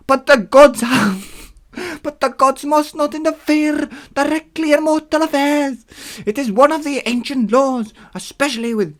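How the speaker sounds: very high, loud put-on god voice; Hermes. Hermes explaining one of the ancient laws, high put-on voice. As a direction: lay down the rule in a high accented voice. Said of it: "Hermes said slowly".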